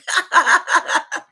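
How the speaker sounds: bright burst of laughter. Real, not acted. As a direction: break into a laugh. Laughing after the line.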